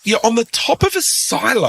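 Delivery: high mock disbelief at a pig farm; disbelieving echo. Radio host to pig farmer caller: high amused disbelief. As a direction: echo it back like it's absurd.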